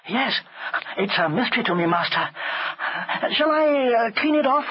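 lightly voiced, hesitant boy; a hint of blameless boy; innocent servant boy. A narrator's reading. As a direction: offer help meekly to the master. Chulak offers to clean.

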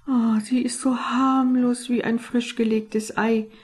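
airy, dismissive reassurance; lightly mocking, eyes rolled; eye-rolling reassurance. A narrator's reading. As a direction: brush off the worry with a hidden sigh. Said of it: "verdrehte heimlich die Augen".

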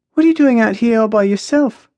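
put-on high, lilting young woman's question; lyrical voice. A narrator's reading. Maya finds Jack alone on the balcony, lyrical. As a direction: ask in a high, musical voice. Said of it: "comes her lyrical voice".

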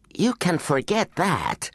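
a slow, high, dismissive scoff. A narrator's reading. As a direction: brush him off with a scornful laugh in the voice. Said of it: "scoffed Jennifer".

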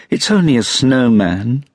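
high-pitched gasp of relief. A narrator's reading. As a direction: let it out high, relieved and astonished. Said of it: "she gasped".

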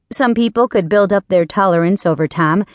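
plain reading voice

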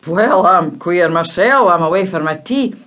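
gruff father. Acted. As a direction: brush it off quickly like a tired dad. Father answers boy.

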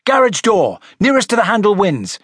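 high, lively call; crying out. Acted. Siri announcing a water-bombing contest, excited cry. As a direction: cry it out as a challenge. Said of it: "cried Siri".

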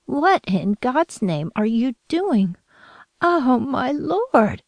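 slow, high horrified cry; shocked shriek. A narrator's reading. Grandmother catching the child at it, slow shocked shriek. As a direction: grandmother recoiling in alarm. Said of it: "Rose shrieked".

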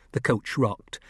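plain reading voice

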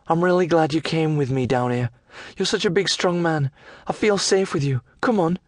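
high, coaxing lass voice. Acted.

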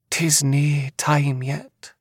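high, drawn-out Scottish seer voice; pressing seer. A narrator's reading. Seer pressing the man she holds, high and slow. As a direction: stretch it out high, insistently. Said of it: "she pressed".

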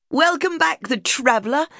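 high, bright greeting called across the hall; joyful welcome called to a returning husband; calling out happily. Retold or imitated. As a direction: call out to him with delight. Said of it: "I called".

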